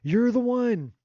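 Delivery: hammed-up smitten proclamation; mock eager declaration. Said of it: "He's like".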